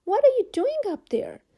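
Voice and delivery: high, loud astonished question; surprised call. A narrator's reading. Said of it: "called the fire chief".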